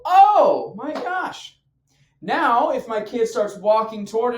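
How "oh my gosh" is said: drawn-out, high, loud "oh"; shocked realization. Acted. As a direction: exclaim it slowly in sudden shock. Acting out his realization after shock.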